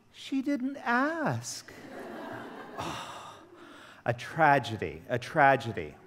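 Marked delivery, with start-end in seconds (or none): 0.1-1.7 put-on very high, very slow childlike reply; slow innocent kid. The son explaining to his father, in a high, very slow childlike voice. 1.8-3.3 weary sigh. Real, not acted. Weary sigh after story.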